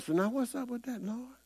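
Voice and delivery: high, soft casual question to God; a hint of a pastor asking the Lord casually; Creflo asking God. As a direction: toss the question up lightly, pitched high. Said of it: "and he asked the Lord".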